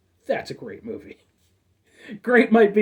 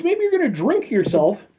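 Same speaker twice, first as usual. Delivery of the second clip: ordinary talk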